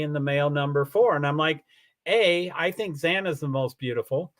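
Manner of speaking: usual speaking voice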